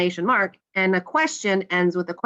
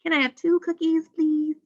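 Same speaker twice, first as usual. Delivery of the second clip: high-pitched polite request; asking a question; 8 semitones higher than usual. Acted. Teacher acting an example question for students, asking. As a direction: ask it as a high, hopeful question. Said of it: "if you ask a question".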